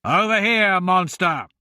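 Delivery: loud taunting call across a hall; shout. A narrator's reading. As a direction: call out to draw the monster. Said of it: "Royce shouted".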